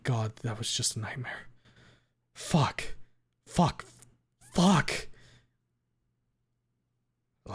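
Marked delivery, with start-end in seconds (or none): 2.3-5.1 rising panic. A narrator's reading. Panic after nightmare.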